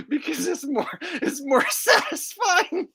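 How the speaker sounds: repeating the joke while breaking into high laughter; laughing. Shown as a demonstration. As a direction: crack up halfway through the line. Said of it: "when I get sad".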